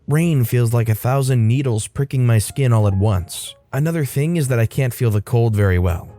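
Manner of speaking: ordinary delivery, nothing marked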